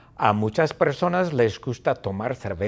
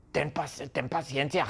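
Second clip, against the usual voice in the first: ordinary delivery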